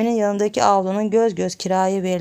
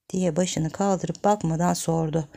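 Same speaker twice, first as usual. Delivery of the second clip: ordinary delivery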